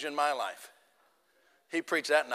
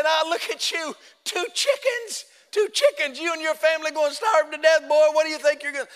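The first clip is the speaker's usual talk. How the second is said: high, incredulous jeering devil voice; hammed-up devil working his victim over; taunting devil; 8 semitones higher than usual. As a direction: pitch it way up and scoff. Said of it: "he began to work me over".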